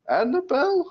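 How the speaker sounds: put-on high, loud old lady calling; grandmother voice. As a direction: call out like a frail grandma. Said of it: "in Grandmother Wiltshire, uh, voice".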